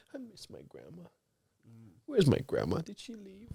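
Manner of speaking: put-on tiny, very soft little-boy voice; forlorn child. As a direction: imitate a small child asking timidly, barely audible. Father voices his sad little son.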